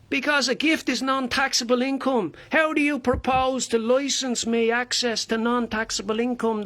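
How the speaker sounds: high, incredulous Dublin rant; indignant Dublin busker. Acted. Busker confronting an official over licensing, indignant Dublin voice. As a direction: challenge the official with mounting outrage.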